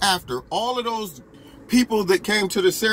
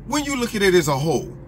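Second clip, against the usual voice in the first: ordinary delivery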